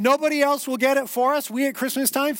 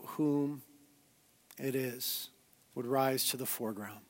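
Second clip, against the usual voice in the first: hushed, measured pastoral prayer; 5 semitones lower, 7 dB quieter than usual. Real, not acted. Pastor praying with the congregation before teaching, prayerful. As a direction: pray quietly with falling phrase ends.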